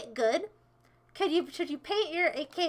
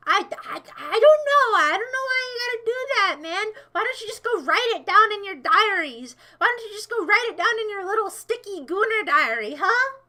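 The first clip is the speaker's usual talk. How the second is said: higher-pitched indignant rant; exasperated scolding; 3 semitones higher, 4 dB louder than usual. Real, not acted. Streamer scolds chat for oversharing fantasies, exasperated. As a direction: tell them off, pushing up in pitch.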